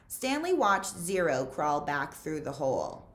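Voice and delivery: ordinary delivery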